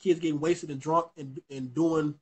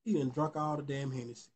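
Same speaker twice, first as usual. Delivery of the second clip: dismayed; 6 semitones lower, 12 dB quieter than usual. Retold or imitated. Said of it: "I said".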